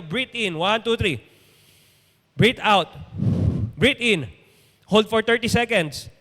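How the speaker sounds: calm breathing instructions; guided breathing. Shown as a demonstration. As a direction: lead the congregation through a breath. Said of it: "hinga nga po tayo".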